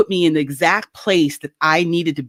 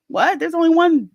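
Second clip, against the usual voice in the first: high incredulous what; baffled disbelief at the list; surprised; 10 semitones higher than usual. Retold or imitated. Reading the job list, baffled and high.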